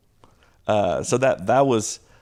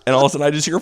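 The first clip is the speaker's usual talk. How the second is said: normal talking voice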